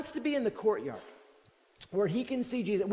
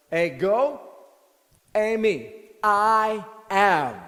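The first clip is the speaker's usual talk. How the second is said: loud, weighty "Ego eimi, I am"; bold declaration; 8 dB louder, much slower than usual. Retold or imitated. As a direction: proclaim it with full authority. Jesus answers the arresting crowd.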